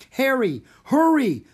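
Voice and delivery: high, loud hurrying call; urgent call. A narrator's reading. Mister Dewey to Harry, calling urgently. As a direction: call out to hurry him along.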